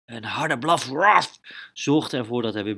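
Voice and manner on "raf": short loud high bark, raf; dog bark. A narrator's reading. Narrator imitating the dog for the kids, loud bark.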